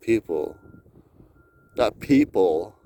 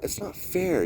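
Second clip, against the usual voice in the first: usual speaking voice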